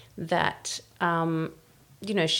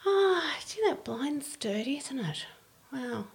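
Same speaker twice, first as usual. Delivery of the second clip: high, breathy near-whisper of a wife dropping hints; coy hinting wife; 4 semitones higher, 4 dB quieter than usual. Retold or imitated. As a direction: sigh out the hint so he offers to do it. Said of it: "these women would go".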